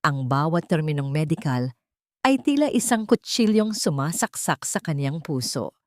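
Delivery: ordinary delivery, nothing marked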